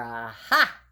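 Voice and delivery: a sudden gleeful aha of discovery; triumphant aha. Acted. Scene: Froggy finds the cookie jar, gleeful aha. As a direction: found it, crow it out.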